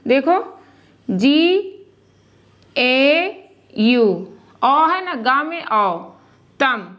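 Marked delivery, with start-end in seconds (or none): 2.8-4.0 ए यू spelled out very slowly, letter by letter; slow spelling. Shown as a demonstration. Teacher spells a vowel sign slowly for pupils. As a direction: drag out each letter for the class. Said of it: "spelling out letters slowly".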